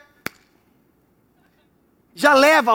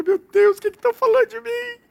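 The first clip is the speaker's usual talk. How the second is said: much higher, squeaky fretful whine; mock panic; 11 semitones higher than usual. The gossip's target fretting over what's said, squeaky mock panic. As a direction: squeal in fake alarm.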